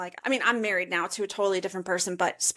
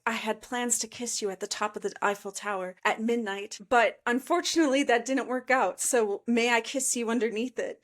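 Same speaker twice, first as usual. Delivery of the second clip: ordinary delivery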